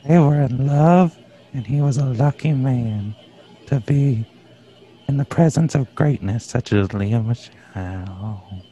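very low, soft theatrical lament; hammed-up mournful. Fan defending [name]'s lost romance, low mournful awe. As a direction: sink into a hushed, reverent eulogy.

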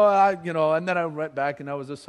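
ordinary talk, nothing marked

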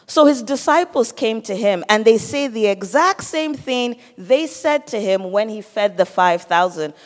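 normal talking voice

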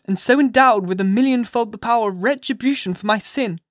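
high, loud, impassioned mother at bay; fierce defiance. A narrator's reading. Hester to the magistrates, fiercely refusing to give up Pearl. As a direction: defy them as if ready to die for her. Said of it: "this wild and singular appeal".

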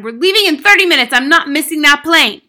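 loud, high, hurried angry father; shouting. A narrator's reading. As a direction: shout impatiently, pressed for time. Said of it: "the father shouted".

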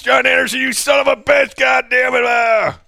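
high, loud excited fan shout; going nuts yelling. Retold or imitated. Drunk fan spotting John in the bathroom, loud excited yell. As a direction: bellow his name like a drunk fan. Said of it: "I just started going nuts".

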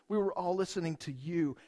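normal talking voice

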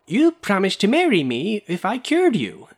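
remonstrating. A narrator's reading. Glassblower reminding Miss Midas of her promise, loud and high. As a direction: demand what was promised. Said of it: "remonstrated the glassblower".

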